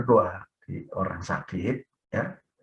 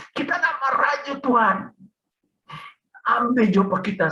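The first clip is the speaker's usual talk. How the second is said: high, strained pleading with God; desperate prayer; 11 semitones higher than usual. Retold or imitated. Sick man begging Tuhan to take him, desperate high plea. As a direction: re-enact begging through unbearable pain. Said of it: "saya langsung berdoa".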